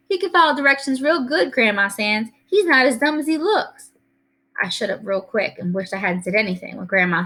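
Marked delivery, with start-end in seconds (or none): 0.1-3.9 lightly voiced helpful kid. Narrator voices the boy speaking up.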